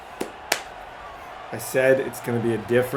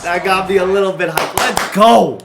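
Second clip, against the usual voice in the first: loud, raised, punchy celebration; hyped up; 6 semitones higher, 9 dB louder than usual. Real, not acted. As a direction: fire up the team after a big play. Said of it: "I'm hyped".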